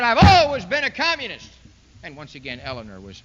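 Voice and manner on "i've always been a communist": loud, defiant proclamation; a loud mocking boast; mock proud declaration. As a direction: bellow it like a dictator unmasking himself. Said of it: "and he said".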